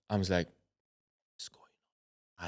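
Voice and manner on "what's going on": very soft, barely voiced murmur; bewildered whisper. Alone behind a locked door, bewildered whisper.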